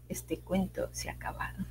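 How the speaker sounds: soft whisper to close the tale; whispered ending. A narrator's reading. Storyteller ending the tale, soft whisper. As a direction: breathe the last words.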